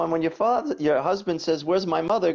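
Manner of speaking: lightly voiced wiseguy setting up the punchline; high, loud joking tone; hint of Bronx tough guy; mock husband. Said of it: "and I go".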